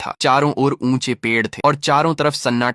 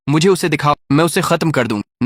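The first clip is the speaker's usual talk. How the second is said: higher, a bit louder angry demand; 3 semitones higher, 3 dB louder than usual. A narrator's reading. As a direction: boast in rage that she'll finish her rival. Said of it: "राक्षसी को बहुत गुस्सा आया".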